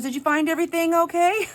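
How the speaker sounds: high, loud customer-service singsong; chirpy cashier. Retold or imitated. Cashier asking customer at checkout, chirpy. Cashier's routine greeting acted out.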